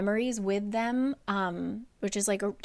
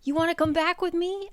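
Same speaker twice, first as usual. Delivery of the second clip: higher, breezy voice; high casual call; 9 semitones higher than usual. Retold or imitated. Rhys inviting Amren back, high and casual. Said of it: "he was kinda like".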